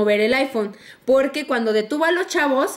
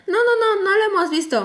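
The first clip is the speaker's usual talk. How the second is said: high-pitched mock protest; hasty retold denial; 7 semitones higher, faster than usual. Kids deny seeing the phone, hasty and higher. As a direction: imitate kids denying it, pitch raised.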